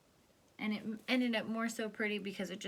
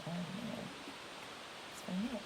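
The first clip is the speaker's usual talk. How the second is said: lively storybook read, rising and falling; storybook voice for a little one; reading to children; 6 semitones higher, 9 dB louder than usual. A narrator's reading. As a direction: read it big and animated for a child.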